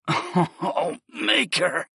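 gasped-out cry; gasping. Acted. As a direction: gasp the words. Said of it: "I gasped".

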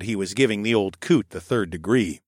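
ordinary delivery

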